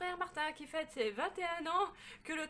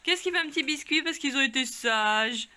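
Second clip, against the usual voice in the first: talking to a dog; 3 semitones higher, 11 dB louder than usual. Real, not acted. Asking the dogs questions.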